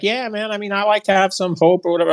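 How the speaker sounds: high, airy mockery of a meek guy; mock dude voice. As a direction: imitate him giving up, pitched high. Mocking the guy's lame reply.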